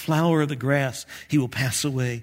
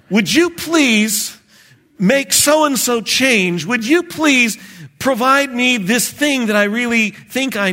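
higher, louder, begging God to do it your way; pleading prayer of a doubter; 6 semitones higher, 4 dB louder than usual. Retold or imitated. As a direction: lean into a whiny, insistent plea. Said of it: "It's a person who says".

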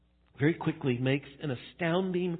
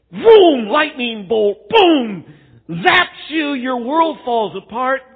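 loud, high booming sound effects; hammed-up catastrophic shout; mock disaster; 8 semitones higher, 13 dB louder, slower than usual. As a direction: blast it out like cartoon lightning strikes. Acts out lightning strike sound effects.

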